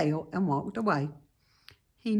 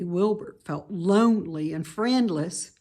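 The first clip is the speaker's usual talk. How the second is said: plain reading voice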